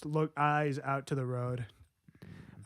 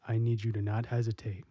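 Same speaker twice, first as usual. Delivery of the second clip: ordinary talk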